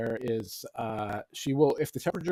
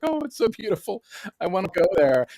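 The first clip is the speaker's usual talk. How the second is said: put-on girlfriend voice; 8 semitones higher, 5 dB louder than usual. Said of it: "she's like".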